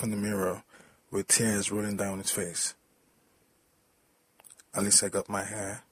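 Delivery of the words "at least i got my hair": ordinary delivery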